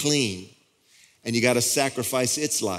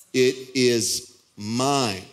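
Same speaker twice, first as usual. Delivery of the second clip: "it is mine" slowly, every word set apart; emphatic; much slower than usual. Retold or imitated. Preacher quoting God's refusal to lend, slow and emphatic. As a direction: stretch out IT, IS, MINE as a firm claim. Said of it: "this is emphatic".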